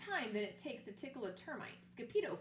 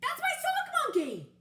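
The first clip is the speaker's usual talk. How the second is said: much higher, much louder outraged cry; yell; 10 dB louder than usual. Acted. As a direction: claim your toy at the top of your lungs. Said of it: "yelled Scapito".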